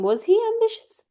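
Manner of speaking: high rising question. Acted. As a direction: wonder aloud, pitched well up. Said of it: "he's, he's considering".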